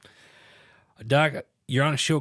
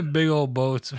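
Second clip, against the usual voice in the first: loud, higher, through laughter; amused, loud burst over a chuckle; laughing; 6 semitones higher, 8 dB louder, slower than usual. Real, not acted. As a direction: laugh out the teasing remark. Teasing about dad's sports car.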